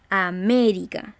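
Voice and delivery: "América" with weight on MÉ; stressed syllable demo. Shown as a demonstration. Teacher showing how we say it, stress on MÉ in "América". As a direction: lean into the MÉ of "América". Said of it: "cargamos la voz".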